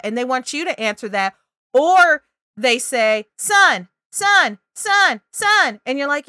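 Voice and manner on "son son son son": put-on high, loud toddler chant; a little one fixated on one word; child repeating. Shown as a demonstration. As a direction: chant it over and over like an insistent toddler.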